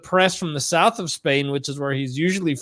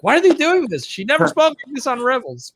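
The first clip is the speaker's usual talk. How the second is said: pitched way up, a mock outraged whine; incredulous exasperation; 9 semitones higher, faster than usual. Fan mocking his own outrage at Hera's new accent, high incredulous whine. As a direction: act baffled and indignant at the show. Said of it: "I'm like".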